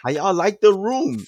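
mock New Orleans mama voice; New Orleans accent. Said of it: "In her New Orleans accent".

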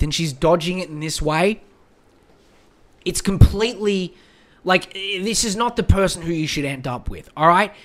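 ordinary talk

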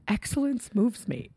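mock weepy and softly high; emotional mother. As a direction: imitate a tearful mom, quietly overcome. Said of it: "she was so emotional".

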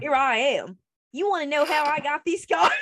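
hammed-up Southern accent; silly Southern accent. Shown as a demonstration. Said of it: "Let me do a Southern accent in a stupid way".

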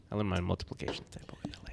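quick breathy aside; whispering. Real, not acted. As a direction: whisper it fast under your breath.

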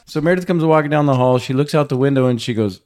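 ordinary talk, nothing marked

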